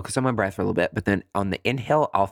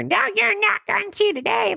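high, put-on commercial character voice; pitched-up squawky interruption; mock side personality. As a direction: pipe up as a pesky inner persona. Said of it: "your side personality".

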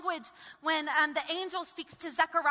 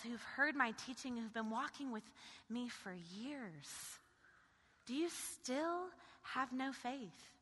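soft intimate preaching; 10 dB quieter than usual. Acted. Preacher voicing Jesus asking frightened disciples, soft tender plea. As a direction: lean in and ask it gently.

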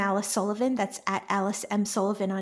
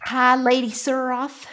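slow Texan drawl; 3 semitones higher, 4 dB louder, slower than usual. Shown as a demonstration. Host tries out a Seanchan line, slow Texan drawl. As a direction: greet her in a drawn-out Texan accent. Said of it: "a Texan drawl".

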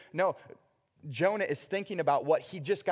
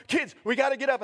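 high, loud wake-up call; urgent parent; 9 semitones higher, 6 dB louder, faster than usual. Retold or imitated. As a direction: rouse the kids in a panic. Acting parent rousing kids.

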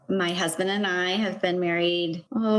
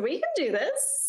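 much higher, upbeat can-do quote; imitating their eager resolve, pitched way up; determined cheer; 9 semitones higher than usual. Couple deciding to apply for the grant, excitedly determined. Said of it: "And we said".

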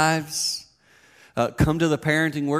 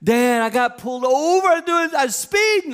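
put-on much higher, louder whiny kid voice; panicked teen son; 12 semitones higher, 6 dB louder than usual. Son confessing a speeding ticket, high whiny voice. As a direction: squeal it like a caught teenager. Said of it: "I'm like".

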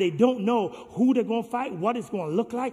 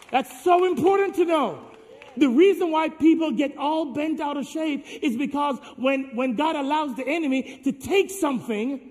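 higher and louder, shouted sermon; full-force preaching; 5 semitones higher, 4 dB louder than usual. Acted. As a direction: drive the point home to the crowd.

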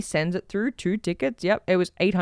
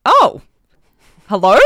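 startled hello; 7 dB louder than usual. Retold or imitated. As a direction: caught off guard by a live voice. Surprised a person answered.

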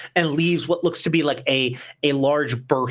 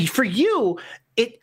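normal talking voice

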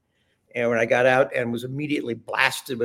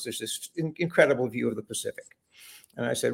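normal talking voice

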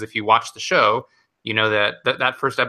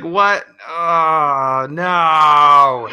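loud, raised, drawn-out dismay; mock audience groan; 5 semitones higher, 6 dB louder, much slower than usual. Imitating a theater hearing Pikachu speak English, loud groaning dismay. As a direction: groan it out like an appalled crowd. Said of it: "the entire theater groaned when it happened".

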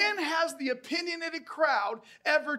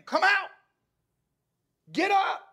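high, loud summoning shout; commanding shout; 8 semitones higher, 7 dB louder than usual. Acted. Preacher as Jesus calling Lazarus from the grave, commanding shout. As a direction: shout the command at full force. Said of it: "he calls Lazarus by name".

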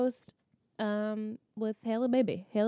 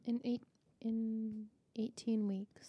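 whispering; 8 dB quieter, slower than usual. Acted. Dramatic reveal of show ending.

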